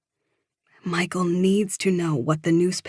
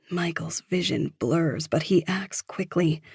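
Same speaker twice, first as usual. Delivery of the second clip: usual speaking voice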